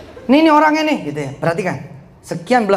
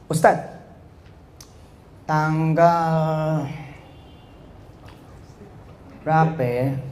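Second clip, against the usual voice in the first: dragged-out words with long pauses; mock hesitant drawl; 3 semitones lower, 6 dB quieter, much slower than usual. Preacher mimics someone dithering over a date to Ustaz, hesitant. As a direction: stall and stretch it, imitating a hesitant speaker. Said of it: "Lah, lu mau ngomong apaan?".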